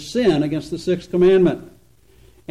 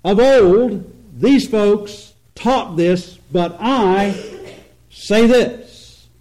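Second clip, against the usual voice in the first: slow, raised, weighty declaration with "I" set apart by a pause; insistent contrast; 4 semitones higher, 4 dB louder, much slower than usual. Retold or imitated. Preacher voicing Jesus against the ancient teachers, emphatically insisting. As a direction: hold "I" out emphatically, then land "say this" high and loud.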